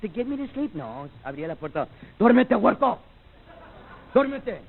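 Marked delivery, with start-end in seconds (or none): 2.2-4.6 higher, firm bedtime command; grandpa's gruff order. Retold or imitated. Grandpa orders grandson to sleep, firm and higher. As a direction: bark the kid to sleep like grandpa. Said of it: "That was my grandpa".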